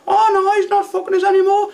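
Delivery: very high mock girl voice; imitating Scruffy Lisa. Taxi driver mimicking his passenger in a very high mock voice. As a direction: squeak it out as the lass. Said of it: "she was like".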